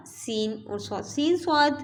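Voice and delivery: ordinary talk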